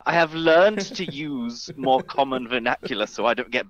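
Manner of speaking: refined, mock-sophisticated British accent; posh Bond-villain English. Brit showing off his fancy natural voice, posh British accent. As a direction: lay on an upper-class British villain's accent. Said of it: "sound like a Bond villain".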